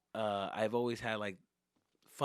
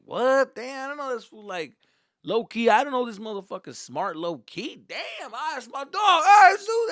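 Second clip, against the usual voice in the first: high, amazed hollering, building to a shout; cheering homies; 10 semitones higher, 12 dB louder than usual. Retold or imitated. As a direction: whoop for your buddy in disbelief. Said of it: "all my homies are cheering for me".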